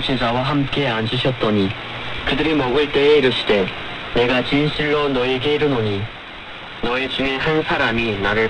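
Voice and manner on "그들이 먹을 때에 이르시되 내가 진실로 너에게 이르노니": ordinary talk, nothing marked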